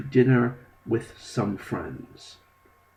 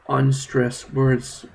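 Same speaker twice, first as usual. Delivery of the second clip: "unstressed words" slow and a bit louder; slow emphasis; 3 semitones higher, 6 dB louder, slower than usual. Shown as a demonstration. Teacher slowly repeating the lesson topic. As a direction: lean on "unstressed words" for the class. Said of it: "words you don't really stress".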